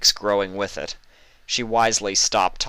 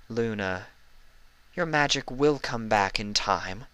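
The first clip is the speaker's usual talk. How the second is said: mechanical reassurance; 4 semitones higher, 3 dB quieter than usual. Acted. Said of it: "said Celestia mechanically".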